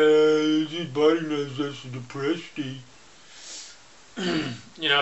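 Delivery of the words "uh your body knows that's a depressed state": a touch of a slumped, mopey voice; a hint of depressed sound; mock depressed voice. Shown as a demonstration. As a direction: droop into a mildly deflated tone. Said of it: "But if you do that".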